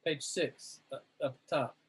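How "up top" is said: ordinary talk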